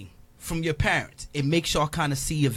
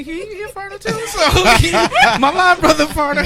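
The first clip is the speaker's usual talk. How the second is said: high laughing voice through the words; cracking up mid-sentence while teasing; laughing while talking; 7 dB louder than usual. Real, not acted. Joking about his co-host.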